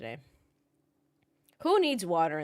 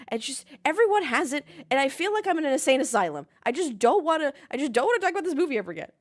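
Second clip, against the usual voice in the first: raised, fed-up voice; exasperated rant; 6 semitones higher, 7 dB louder than usual. Real, not acted. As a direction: vent loud and fast at the ads. Rant about F1 movie ads.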